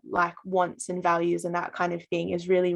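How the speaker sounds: ordinary delivery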